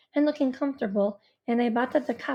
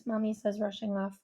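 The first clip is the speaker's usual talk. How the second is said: plain reading voice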